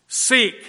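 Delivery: a single emphatic, falling SEEK; emphasis on SEEK. Shown as a demonstration. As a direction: hit SEEK as the key word. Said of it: "that's the emphasis".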